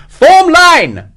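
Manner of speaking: very high, loud drawn-out command; shouted order. A narrator's reading. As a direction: bellow the order to your men under fire. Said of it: "Morris shouted".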